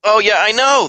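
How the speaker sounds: high, loud exasperated yes; emphatic agreement. Real, not acted. Gamer griping about curveballs, emphatic agreement. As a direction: burst out in agreement.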